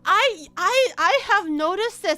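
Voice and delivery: excited agreement. Pretend. Mock answering her own question.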